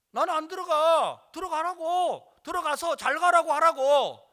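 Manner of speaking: loud, high insistent shouting; heated argument. Retold or imitated. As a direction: argue at full voice, stretching endings. Retold shouting match at doorway.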